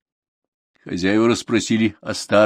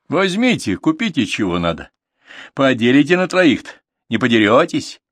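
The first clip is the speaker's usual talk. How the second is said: jokingly; 5 semitones higher, 4 dB louder than usual. Acted. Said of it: "шутливо спросил он".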